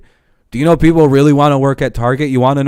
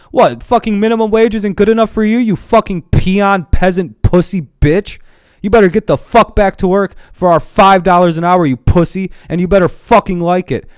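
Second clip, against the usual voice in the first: higher, louder sneering employer; mock arrogant boss; 6 semitones higher, 3 dB louder than usual. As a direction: bark at a worker like a contemptuous boss. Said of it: "They're basically saying".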